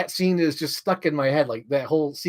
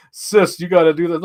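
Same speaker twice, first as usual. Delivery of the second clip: mock egging on; 4 semitones higher, 9 dB louder than usual. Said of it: "he's just like".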